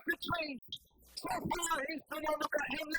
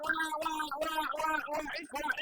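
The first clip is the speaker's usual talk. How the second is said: imitated baby cry; 3 dB louder, slower than usual.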